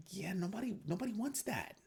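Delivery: high, sarcastic scoff; sarcastic retort. Retold or imitated. Vlogger mocking a central-bank claim, high sarcastic scoff. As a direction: scoff at the idea. Mock reply to Justin Sun.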